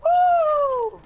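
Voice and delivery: high, loud victory whoop; excited whoop. Real, not acted. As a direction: whoop like you just won. Celebrating a kill in game.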